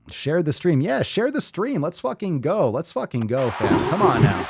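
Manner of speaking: high, excited rallying cry; rally the stream like a hype man; hyped up. Real, not acted. Hyping up live stream audience.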